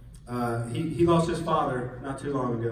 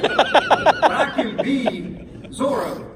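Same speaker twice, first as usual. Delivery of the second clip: loud, high, drawn-out heroic declaration; triumphant boast; 9 semitones higher, 8 dB louder, much slower than usual. Retold or imitated. Boy deciding he will be Zorro, triumphant boast. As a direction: proclaim it like a kid hero, stretched out.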